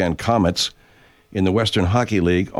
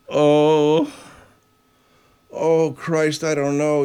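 drawn-out, higher-pitched groaning oh; imitated moaning patient; 5 semitones higher, much slower than usual. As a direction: lightly mimic a woman moaning in discomfort. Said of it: "Maria is just sort of going".

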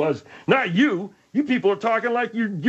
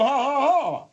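higher mock ha-ha; imitated mocking laugh; 4 semitones higher than usual. Bud throws back the laugh, mocking imitation. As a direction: mimic someone's jeering laugh. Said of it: "the way you was going".

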